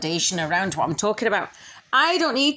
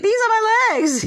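much higher, louder exasperated cry; exasperated exclamation; 6 dB louder than usual. Retold or imitated. Showing her legs to trolls, higher and louder, fed up. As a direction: fling it out, fed up. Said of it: "And I was like".

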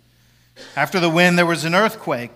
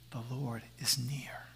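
slow unvoiced whisper, much softer; 5 semitones lower, 16 dB quieter than usual. Shown as a demonstration. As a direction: breathe it close to the ear. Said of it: "a whisper reminds us".

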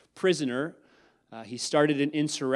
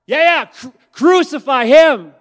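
mob yell; 13 semitones higher, 21 dB louder than usual. Acted. Preacher voicing the mob at Jesus, yelling. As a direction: roar it like the bloodthirsty mob.

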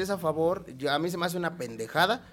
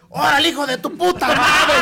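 mock stadium yell; 9 semitones higher, 9 dB louder than usual. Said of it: "Siguiente jugada".

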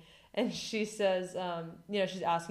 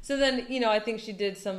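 normal talking voice

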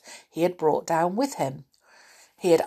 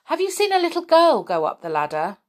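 high, raised call to someone below; 11 semitones higher, 4 dB louder than usual. A narrator's reading. Moonface to the goblin on the ladder, calling down. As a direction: call down to catch their attention. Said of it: "he called down to the goblin".

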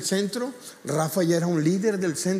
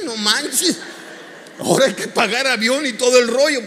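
laughing disbelief, higher and louder; 8 semitones higher, 8 dB louder than usual. Real, not acted. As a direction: laugh through the joke about the bill. Laughs at growing cost.

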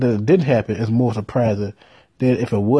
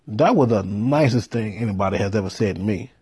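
ordinary talk

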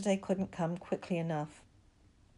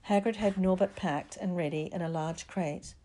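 plain reading voice

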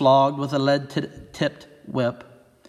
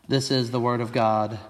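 ordinary talk

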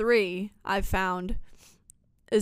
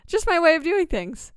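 laughing; 7 semitones higher, 4 dB louder than usual. Real, not acted. Laughs at own quip.

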